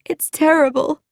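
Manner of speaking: high-pitched distressed exclamation; acted upset, voice jumping up high; crying. A narrator's reading. Sylvia tells Frankie the awful news, crying. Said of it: "she was crying".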